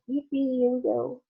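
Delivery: high, gleeful cheer; excited cheer. Acted. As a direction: ride the thrill upward. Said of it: "shouted Grandpa Joe again".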